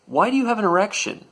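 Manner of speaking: high-pitched innocent-sounding query; pointed question. Retold or imitated. Loudly confronting guard before onlookers.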